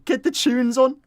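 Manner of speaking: high-pitched echo of a Scouser; Scouse accent. Retold or imitated. Streamer echoing the chase clip after laughing, high mock Scouse accent. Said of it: "That's the Scouse accent".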